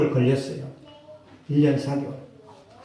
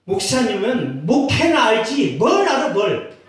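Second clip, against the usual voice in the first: scoffing retort; 5 semitones higher than usual. Retold or imitated. Voices a scoffing listener.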